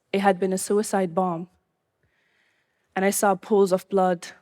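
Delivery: ordinary delivery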